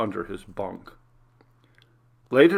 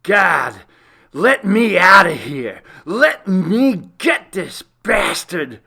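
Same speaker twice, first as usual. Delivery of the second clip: loud, high anguished yell; furious shout; 8 semitones higher, 11 dB louder, slower than usual. Acted. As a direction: roar it at the heavens in rage. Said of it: "roar of frustration and anger".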